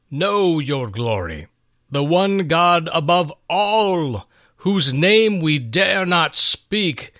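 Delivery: a conqueror weighing a prophecy aloud; king Cyrus, awed. Acted. Cyrus speaks to Daniel.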